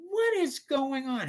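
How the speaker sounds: high-pitched mock maternal outrage; shocked mom. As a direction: demand answers in a put-on mom voice. Mom demands answers from husband.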